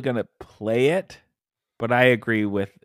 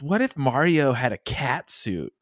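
imitating Miyamoto; slower than usual. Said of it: "He probably sits around and goes".

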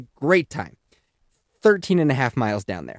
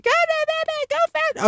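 put-on high squeaky child urging go; high child cheering; kid voice. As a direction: squeal it like a small kid in the back. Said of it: "The kids are in the back just like".

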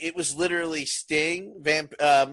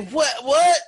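rising to a much higher, louder shocked "What?"; mock disbelief, louder the second time; surprised what; 11 semitones higher, 8 dB louder than usual. Cohost about to object to a wrong fact, high shocked cry. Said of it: "I was about to say".

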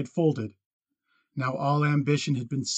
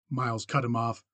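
ordinary delivery, nothing marked

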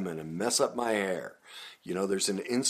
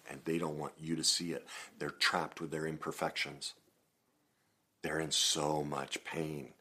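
soft earnest coaching; 5 semitones lower, 7 dB quieter than usual. Acted.

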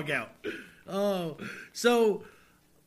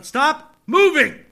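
sudden call-out; 6 semitones higher, 9 dB louder, slower than usual. Real, not acted. Breaks off, calls out.

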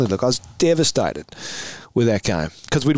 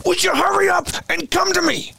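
very high, exasperated holler; imitated impatient yell. Teammate calling from ten metres away, yelling impatiently. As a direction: demand it in a strained high yell.

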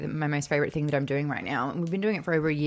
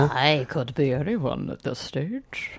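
mysterious voice; 4 semitones lower, 3 dB quieter, much slower than usual. Pretend. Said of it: "What is this voice?".

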